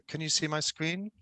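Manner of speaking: ordinary delivery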